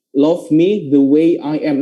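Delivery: slow, separated words; measured teaching pace; slow model reading. Shown as a demonstration. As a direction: read "love me the way I am" slowly. Teacher reads the example slowly.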